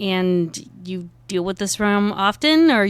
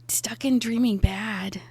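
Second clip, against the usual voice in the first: whisper; 3 semitones higher than usual. Acted. Character despairs, unvoiced.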